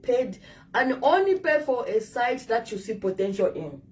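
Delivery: ordinary delivery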